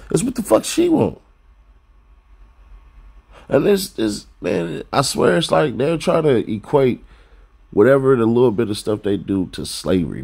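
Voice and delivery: ordinary talk, nothing marked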